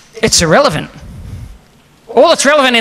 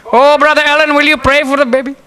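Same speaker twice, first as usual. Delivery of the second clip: high put-on pastor voice; high, imploring pastor impression; pastor's request; 10 semitones higher, 4 dB louder than usual. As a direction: imitate the pastor, pitched well up. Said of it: "he says to the congregation".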